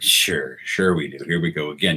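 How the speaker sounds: low, resigned retold reply; weary scepticism. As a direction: go along without believing a word of it.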